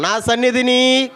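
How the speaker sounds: high, slow, loud lead line; call and response. Shown as a demonstration. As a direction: lead the church in shouting it. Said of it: "ఆ మాట అందరం చెప్దాం". (సంఘాన్ని నడిపిస్తూ గట్టిగా)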